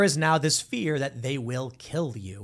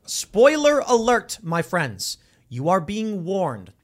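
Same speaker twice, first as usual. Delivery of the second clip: exaggerated public warning; spoiler warning; 3 semitones higher, 3 dB louder, much slower than usual. Real, not acted. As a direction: sound the alert slowly and loudly. Mock-solemn warning to the audience.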